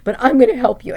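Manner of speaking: high mock-dramatic sister voice; overly dramatic fake crying imitation; mocking fake concern. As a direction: ham up her fake helpfulness. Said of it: "being overly dramatic".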